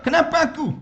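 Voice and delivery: mock whine of a grumbler; whiny complaint. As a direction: act out someone blaming God. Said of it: "Dia mula".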